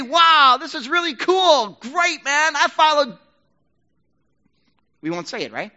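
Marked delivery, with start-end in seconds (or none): 0.0-3.2 a loud, much higher, hammed-up cheer, slow on "this is really cool"; mock boast. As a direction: sneer at the old life by playing it proud. Mocking the old life's boast.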